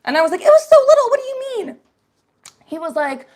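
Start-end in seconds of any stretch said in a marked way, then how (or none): 0.4-1.8 way up high, loud and squeaky; high squeaky protest. Retold or imitated. Telling the guy the food was tiny, high squeaky protest. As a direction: squeal it out in disbelief.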